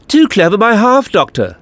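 high, loud gloating voice; alien villain's taunt. A narrator's reading.

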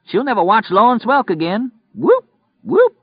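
wild high yell climbing into whoops. Acted. Killer crowing to his partner over the victim, yelling. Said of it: "Polk yelled".

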